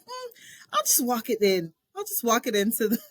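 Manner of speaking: high, pleased with the idea; as if a clever plan just struck; a sudden light idea; bright idea. Retold or imitated. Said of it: "I was like".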